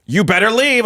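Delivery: a hint of a bossy girl warning you off; lightly voiced girl. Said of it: "that girl was like".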